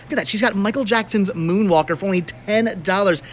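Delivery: usual speaking voice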